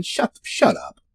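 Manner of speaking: whispered, unvoiced shush; hushed shut up. Hushing an imagined chart hack in a whisper. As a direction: breathe it out as a hushing aside.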